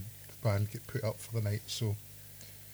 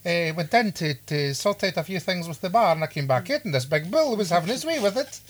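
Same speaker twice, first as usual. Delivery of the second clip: put-on higher, louder Highland Scottish accent; 6 semitones higher, 5 dB louder than usual. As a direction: tell it in a broad Highland accent. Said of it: "that was just my random Highland accent".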